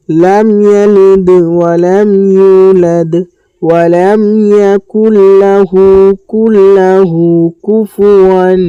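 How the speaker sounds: slow melodic tajweed chant; measured Quranic recitation. Shown as a demonstration. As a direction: recite slowly, drawing out each vowel.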